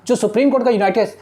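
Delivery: usual speaking voice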